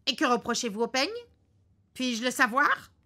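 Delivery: mild acted fury of the husband; furious. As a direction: bite out the question. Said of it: "dit-il furieux".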